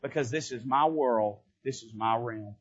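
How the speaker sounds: devil boasting. Retold or imitated. Preacher voicing the devil boasting to God, low and smug. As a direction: purr it low like a gloating ruler. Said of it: "And the devil says".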